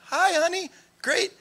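high, sweet morning phone greeting; put-on chirpy mom voice; mother-in-law as if nothing happened. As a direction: imitate a mom breezily greeting her daughter. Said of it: "last night's conversation never happened".

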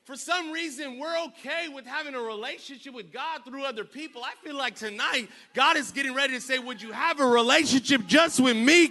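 loud, high preaching climb; preacher driving the congregation toward commitment; full-force preaching. Acted. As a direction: build the sermon to its peak.

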